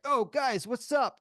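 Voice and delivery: fake casual. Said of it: "I'm like".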